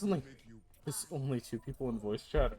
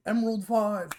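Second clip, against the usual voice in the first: excited shout; 7 semitones higher, 7 dB louder than usual. Real, not acted. Excited rank callout.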